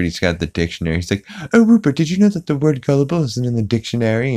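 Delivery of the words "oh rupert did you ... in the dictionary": high-pitched precocious cartoon child impression; Stewie. Stewie showing Rupert the dictionary, mock child voice. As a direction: pitch it up, imitating a cartoon baby. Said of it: "Stewie is playing with Rupert".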